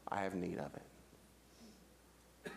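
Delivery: very low, slow and soft; hushed firm answer. Acted. Jesus answering one who won't surrender, hushed and firm. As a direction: let it sink almost to a murmur.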